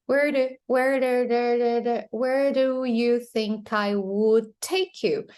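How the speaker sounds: "do" tapped repeatedly, then run into the question; tap sound. Shown as a demonstration. Teacher shows learners the tap sound, repeated flap. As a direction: drill the flick in "Where do" before the full line. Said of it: "there is a tap sound".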